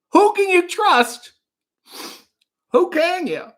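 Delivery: very high-pitched comic impression, half-sung lyrics. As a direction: recite song lyrics way up high, in a put-on voice. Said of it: "[name] singing Trust".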